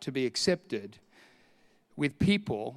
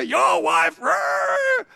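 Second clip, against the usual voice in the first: higher, louder hammed-up roar; mock angry outburst; 4 dB louder, much slower than usual. Offended man storms back about the wife, hammed-up roar. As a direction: bellow it like the offended guy.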